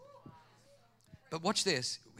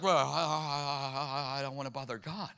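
stammering hesitation; 6 semitones lower, 4 dB quieter than usual. Retold or imitated. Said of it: "I'm like".

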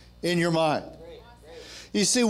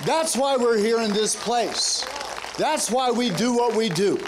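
high, driving preacher cadence; rally the congregation with rising fervor; impassioned call, stretching the key phrases; rousing preaching; 6 semitones higher than usual. Real, not acted. Preacher rallies the men.

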